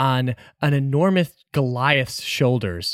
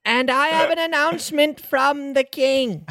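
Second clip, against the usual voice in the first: town crier; 12 semitones higher than usual. Acted. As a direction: proclaim it like a crier.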